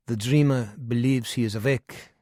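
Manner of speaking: put-on lecturing old German psychiatrist; knowing German doctor, explaining; German accent. Kimmer voiced by narrator.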